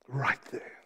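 breathy whispered echo. Acted. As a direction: hush it to a whisper for the congregation. Whispered repeat of phrase.

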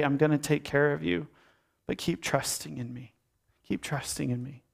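soft, gentle voice of God; calm and tender, hushed by the close; tender reassurance. Acted. Voicing God's tender reassurance to listeners.

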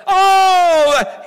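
high, loud pleading shout; Bartimaeus crying out. Acted. Blind Bartimaeus shouting to Jesus over the crowd, high and loud. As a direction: call out over the crowd, begging. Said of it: "he didn't stop".

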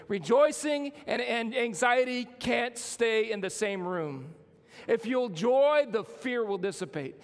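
preaching at full force. Acted. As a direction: drive it home to the congregation. Preaching builds.

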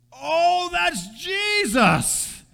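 high, slowed-down wondering realization; dawning recognition. Retold or imitated. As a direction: let it dawn slowly, amazed. Believer realizing it's Jesus.

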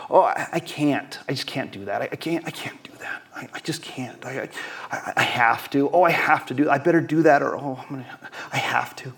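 mock-helpless, voice sinking to a faint whisper; halting, defeated stammer of someone who can't; powerless voice. Preacher showing the congregation a powerless person, helpless and defeated. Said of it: "The powerless voice sounds something like this".

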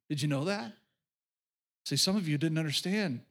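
ordinary talk, nothing marked

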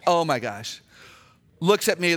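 normal talking voice

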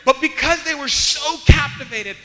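loud, high, driving sermon delivery; full-force preaching. Real, not acted. Preacher telling how prisoners stayed captivated, full-force preaching. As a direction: preach it loud and urgent to the room.

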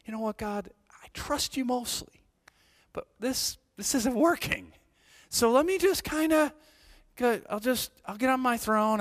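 high, pleading voice bargaining with God; a hint of pleading self-talk; mock prayer to God. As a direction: voice yourself begging God to step aside. Pastor voicing his prayer to God.

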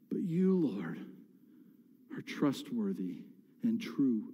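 soft, low, near-whispered prayer with long pauses; hushed reverence. Acted. Psalmist turning to God in trust, hushed. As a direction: drop to an awed hush.

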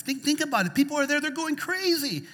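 high-pitched excited exclamation; worked-up amazement. Real, not acted. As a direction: exclaim at the crowd's craziness.

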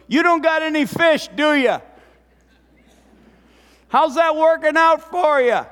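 sarcastic ribbing from the shore; mocking jab. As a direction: needle them like a smug onlooker. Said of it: "Pretty much what he said was".

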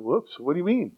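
soft, startled "Whoops"; a hint of caught-off-guard listener; mock surprise. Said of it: "You're thinking".

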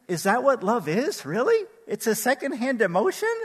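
high-pitched mock disbelief, rising at the ends; playfully incredulous, pitch climbing on each question. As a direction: question it as if you can't believe it. Mock disbelief at song lyric.

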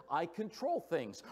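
ordinary delivery

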